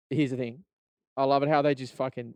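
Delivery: ordinary talk, nothing marked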